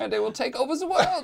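high, laughing German mad-scientist voice; evil German accent. Acted. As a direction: plot world domination in a hammy German accent. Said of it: "that is the accent of evil".

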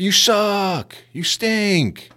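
high mock heckle at the team; heckling yell. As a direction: taunt them from the stands. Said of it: "telling them how badly they sucked".

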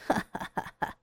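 breathy sinister chuckle; soft wicked laughter; evil laugh. A narrator's reading. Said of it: "赵志敬笑着".

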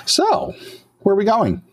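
ditzy high chirp; high bubbly voice. Acted. Maria asks Yova where they are going, high and bubbly. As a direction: ask it brightly, sing-song high.

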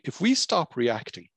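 ordinary talk, nothing marked

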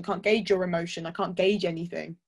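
ordinary talk, nothing marked

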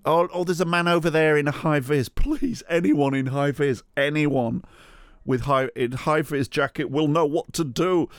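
high, hurried, hammed-up plea; mock frantic call. Woman calls out to anyone in high vis, mock frantic. Woman voiced calling for help.

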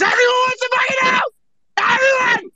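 high yelled rant; ranting shout. Real, not acted. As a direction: yell it up high at him. Ranting at Bart.

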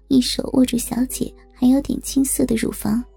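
usual speaking voice

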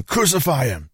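high, strained cry of a crowd; narrated mob shouting. A narrator's reading. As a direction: cry out high, without full volume.

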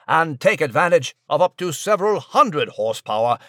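British newsman, crisp and self-important; pompous news reporter. Acted. Nigel's news report character.

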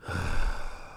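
long weary exhale; tired sigh of giving up; heavy sigh. Real, not acted. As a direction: let all the air out, defeated. Long sigh after rant.

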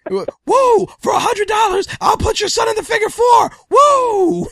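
loud, high shouted woos and boasts; Ric Flair impression. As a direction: strut it like the Nature Boy. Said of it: "Ric Flair offering for a hundred".

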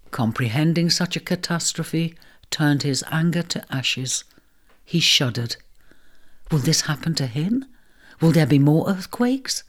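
plain reading voice

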